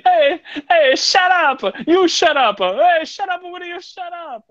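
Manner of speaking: high, loud hammed-up Italian shouting; comic Italian dad yelling back; mock Italian shouting. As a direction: yell shut up in a goofy Italian accent.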